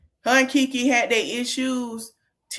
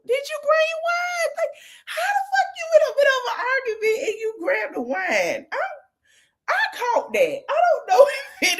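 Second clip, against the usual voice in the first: very high, squealing voice through real laughter; cracking up. Real, not acted. Recapper reacting to the wine grabbed mid-argument, cracking up. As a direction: squeal in disbelief, barely holding the laughter in.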